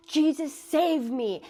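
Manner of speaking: slow, high, loud plea; cry for help. Retold or imitated. Girl calling on Jesus in hell, slow loud cry. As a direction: cry out to be saved.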